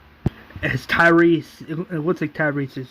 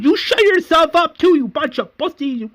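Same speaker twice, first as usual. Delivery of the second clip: very high, loud mock screech; retold scream; 13 semitones higher, 5 dB louder than usual. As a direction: scream the insult back, hammed-up. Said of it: "Merle screams at Vesta saying".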